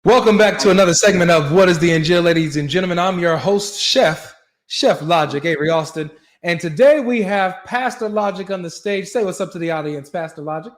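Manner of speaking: loud, high-pitched segment announcer; big mock-TV welcome, starting loud and easing off; show host intro. Hosts a recurring show segment.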